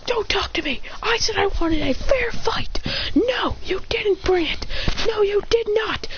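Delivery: high, half-whispered scolding that slows at the end; mock scolding. Sasuke scolding Boruto for cheating, high half-whispered. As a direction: lecture him, disgusted, mostly under the breath. Angry scolding after smacking hand.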